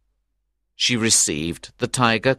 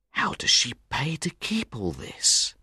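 higher, softer gossiping question; wondering friends' voice; 4 semitones higher, 5 dB quieter than usual. A narrator's reading. Friends wondering about the cottage, soft higher questioning voice. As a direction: ask it softly, pitched up in wonder.

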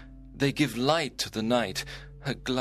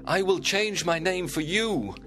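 calling out; 7 semitones higher than usual. A narrator's reading. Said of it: "Romeo called out".

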